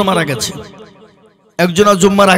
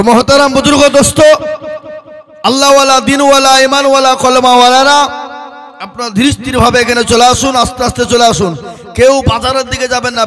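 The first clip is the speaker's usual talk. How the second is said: preaching at full force; 4 semitones higher than usual. Acted. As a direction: raise the voice and summon the faithful. Preacher calls the gathering.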